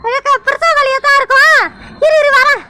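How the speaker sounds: a fussing grandmother rushing to help; worried grandmother. Acted. Grandmother voice again.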